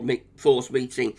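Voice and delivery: ordinary talk, nothing marked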